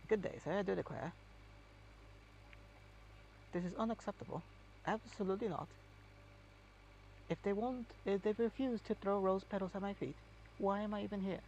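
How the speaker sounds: a haughty English aristocrat put on high; hammed-up posh British accent, pitched high. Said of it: "it's not European at all. In, like".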